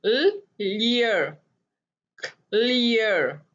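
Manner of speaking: Clear stretched slowly, ear sound held; slow blending. Shown as a demonstration. Phonics teacher modelling the word slowly for pupils. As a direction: draw out clear so each sound shows. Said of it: "इन दोनों की आवाज आ रही है ईयर".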